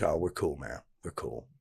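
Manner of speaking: low, hushed, brotherly let-off; imitating quiet reassurance. Other inmate making peace with a handshake, quietly reassuring. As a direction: drop to a near murmur, letting it go. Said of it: "Just like this".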